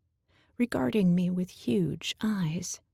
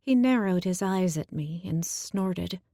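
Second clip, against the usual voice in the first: ordinary delivery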